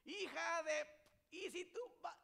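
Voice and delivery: very high, loud cut-off cursing; hammed-up furious shriek; angry yelling. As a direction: scream insults in an acted quarrel. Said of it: "qué hacemos cuando estamos enojados".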